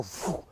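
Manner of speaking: airy rushing whoosh; imitated whoosh. Guide showing cavalrymen charging through, imitated whoosh. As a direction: blow a whoosh like riders charging past.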